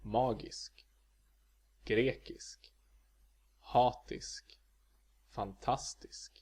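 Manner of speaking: magisk, grekisk, hatisk, fantastisk, each single-peaked; Swedish accent one. Shown as a demonstration. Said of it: "adjectives ending in isk".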